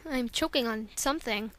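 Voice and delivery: usual speaking voice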